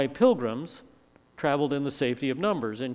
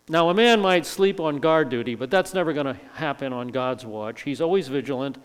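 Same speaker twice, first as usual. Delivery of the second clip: ordinary delivery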